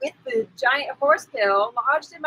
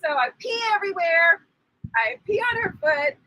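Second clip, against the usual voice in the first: usual speaking voice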